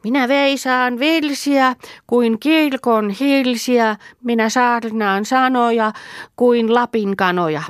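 high, slow mock sermon; playful child sermon; high-voiced child preacher. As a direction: preach it up high like a little pastor. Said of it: "korkealla äänellä saarnata".

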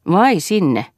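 a spiteful little scoff after a nasty laugh; mocking breathy "so, there?"; nasty sneer. A narrator's reading. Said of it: "naurahti ilkeästi".

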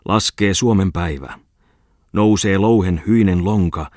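loud, grand, stern declamation; pathetically fierce. Acted. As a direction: thunder the verses like a rousing anthem. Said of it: "luki pateettisen tuimasti".

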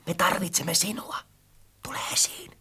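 soft, pleading whisper; weak whisper. A narrator's reading. Friend begs book to appear, whispering weakly. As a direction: coax it out in a faint whisper. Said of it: "hän kuiskasi heikolla äänellä".

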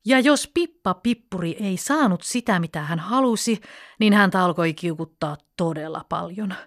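ordinary delivery, nothing marked

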